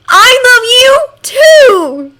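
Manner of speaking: high, loud and drawn out slowly; delighted. A narrator's reading. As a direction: sing it out full of delight. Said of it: "Hisashi hums, delighted".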